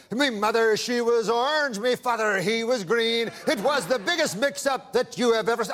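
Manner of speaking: hammed-up Irish brogue; playful mock-Irish lilt. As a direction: put on a comic Irish accent. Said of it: "you're an Irishman".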